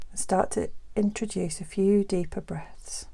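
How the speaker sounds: soft, hushed meditation voice with long pauses; guided meditation. Acted. Teacher easing listeners into breathing and letting go, guided meditation. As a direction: guide listeners gently into the body, softly and unhurriedly.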